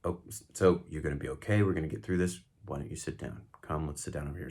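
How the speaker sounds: soft, gentle coaxing; calm encouragement. Shown as a demonstration. Helping a panicking friend sit down, gentle. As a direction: ease them down to sit. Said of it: "I would encourage somebody".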